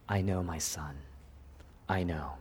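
low, soft, sorrowful acknowledgement; almost crying. Acted. Dying Jacob answering his son, near tears. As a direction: drop to a hushed, tender grief. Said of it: "He's almost crying".

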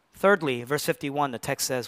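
usual speaking voice

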